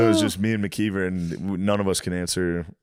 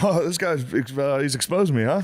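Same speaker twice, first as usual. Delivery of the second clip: caught-out co-star; 7 semitones higher than usual. Retold or imitated.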